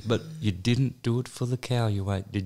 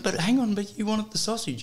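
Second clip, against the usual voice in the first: higher, lightly put-on salesman reasoning; mock salesman; 10 semitones higher than usual. Mimicking the prankster reasoning with the woman, higher put-on voice. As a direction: play innocent, pitched up. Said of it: "he's going".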